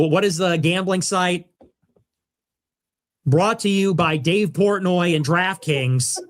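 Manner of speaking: ordinary talk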